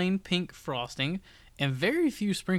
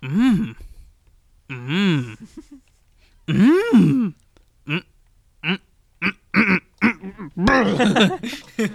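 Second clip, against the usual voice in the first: chewing hums; 5 semitones higher, 7 dB louder, much slower than usual. Pretend. Mock chewing sounds.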